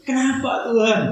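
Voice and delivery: high, pained cry to God; hurt question. Acted. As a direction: ask God why, aching. Voicing grieving believer's protest. (pertanyaan pilu bernada tinggi)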